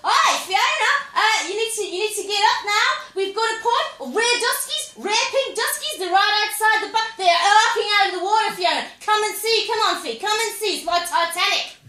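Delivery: high, loud, over-excited skipper; chipper skipper. Acted. Skipper urging seasick Fiona up to see dolphins, chipper shouting. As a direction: call out excitedly like a chirpy boat captain. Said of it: "this very chipper skipper".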